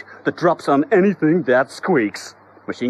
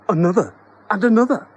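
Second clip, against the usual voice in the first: excited rush; 9 semitones higher, 5 dB louder, faster than usual. A narrator's reading. Vans arriving in rush.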